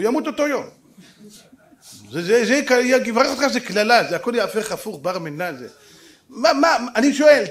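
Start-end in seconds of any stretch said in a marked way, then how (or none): none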